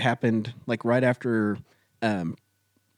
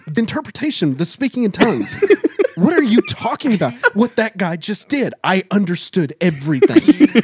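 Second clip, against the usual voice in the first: higher, louder, half-laughing insistence; exasperated retold protest; 7 semitones higher, 5 dB louder than usual. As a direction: re-enact a flustered argument, voice climbing. Retelling his baffled, heated protest.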